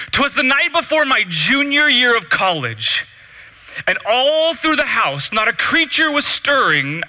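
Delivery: mock-grand poem reading with drawn-out words; storybook recital. Speaker parodies the Christmas poem about college, storybook recital.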